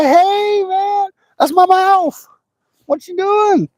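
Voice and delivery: very high, drawn-out complaining trout; indignant fish voice. Acted. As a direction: squeal it slowly as the offended fish. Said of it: "He's like".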